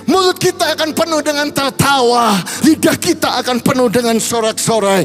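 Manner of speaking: fervent preaching. Acted. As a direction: preach it with growing fire to the congregation. Preacher builds to altar call. (khotbah berapi-api yang makin keras)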